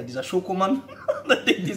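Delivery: normal talking voice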